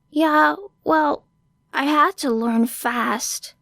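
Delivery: high, slow, cracked small voice; broken, wet voice. Acted. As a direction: sound fragile, on the edge of tears. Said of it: "Let it be broken and just a little wet".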